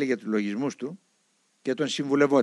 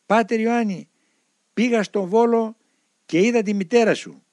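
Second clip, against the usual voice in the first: calling out; 8 semitones higher, 5 dB louder than usual. Retold or imitated. Priest shouting across the harbour.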